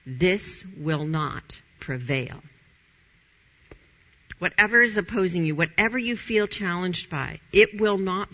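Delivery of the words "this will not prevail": "This" stressed, said slowly; slow it down and lean on "This"; slow declaration. Shown as a demonstration. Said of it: "put this word up there".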